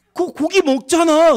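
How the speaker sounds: slow teasing accusation; mock accusation. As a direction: spell it out slowly to her. Retold accusing quote.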